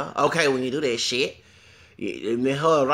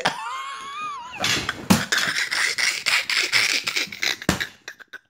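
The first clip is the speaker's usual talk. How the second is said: shrieking laugh; real laughter, high pitched; high cackle. Real, not acted. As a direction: burst into a squealing high laugh. Laughing at her own story.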